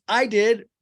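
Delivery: high, loud chirped answer; hammed-up enthusiastic friend; eager reply. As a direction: pipe up eagerly. Acts out Angie K's eager answer.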